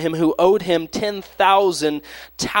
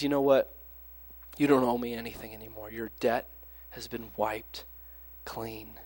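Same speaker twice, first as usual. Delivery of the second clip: tender forgiveness; 4 semitones lower, 9 dB quieter than usual. Retold or imitated. Master forgives the debt.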